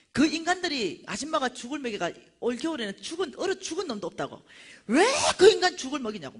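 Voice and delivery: high, loud scolding; imitated ranting vendor. Tape seller berating preacher for feeding homeless, ranting. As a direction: make an angry fuss, ending loudest. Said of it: "막 난리나".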